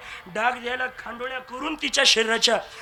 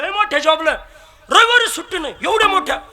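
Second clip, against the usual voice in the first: higher, louder disbelieving question; incredulous; 7 semitones higher, 6 dB louder than usual. Acted. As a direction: press her in disbelief. (आश्चर्यचकित, चढ्या आवाजात प्रश्न)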